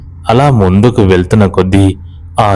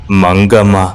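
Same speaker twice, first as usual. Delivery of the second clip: ordinary delivery, nothing marked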